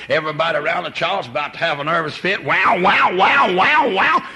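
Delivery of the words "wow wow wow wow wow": put-on very high, loud baby wail climbing; the baby's cry rising to a shriek; imitating a crying baby. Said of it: "when they just".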